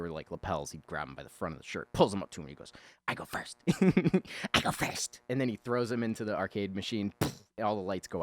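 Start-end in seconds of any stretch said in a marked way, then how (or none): 3.1-5.2 menacing whisper. Retold or imitated. The strange guy threatening the pizza guy, in a whisper. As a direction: hiss the threat into his ear.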